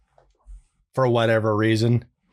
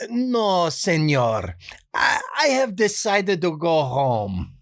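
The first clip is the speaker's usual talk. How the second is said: put-on high, slow pleading in a Spanish accent; a nice guy pleading in a Spanish accent; 5 semitones higher, slower than usual. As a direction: beg off sweetly in a Spanish accent. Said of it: "that sort of pleading quality".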